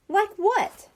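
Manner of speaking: put-on high, scornful kid's scoff; dismissive high-pitched scoff. A narrator's reading. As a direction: scoff at the idea. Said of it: "Bradley scoffed".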